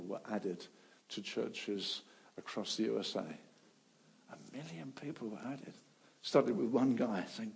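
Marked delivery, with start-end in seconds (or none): 4.3-5.7 much softer, awed repetition; hushed awe. Real, not acted. Preacher repeating the million in a soft hush. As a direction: drop to a near hush for effect. Preacher marvels at revival figures.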